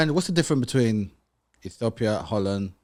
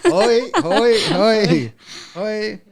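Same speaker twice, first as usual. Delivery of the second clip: Hoi, hoi, hoi, hoi squeaked much higher and louder; playful mock Dutch greeting; 11 semitones higher, 5 dB louder, much slower than usual. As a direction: bounce the Hoi up high like a cheerful echo. Host repeats Dutch greeting playfully.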